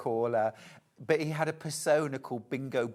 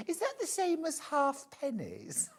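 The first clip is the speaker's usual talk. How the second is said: put-on puzzled young woman; 13 semitones higher, 3 dB quieter than usual. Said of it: "she said".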